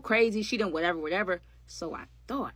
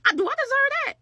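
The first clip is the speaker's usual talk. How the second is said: sudden very high incredulous question; incredulous squeak; 5 dB louder than usual. Acted. Asking the audience if she deserved it, incredulous high squeak. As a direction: squeak it out in disbelief.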